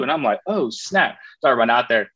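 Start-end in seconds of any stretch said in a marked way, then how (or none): 0.5-1.2 startled. Retold or imitated. Own startled reaction retold.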